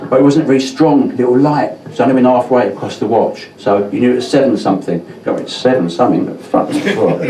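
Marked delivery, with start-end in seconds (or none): none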